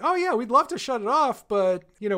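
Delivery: high, chirpy mock-breezy reply; breezy mock cheer. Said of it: "They're like".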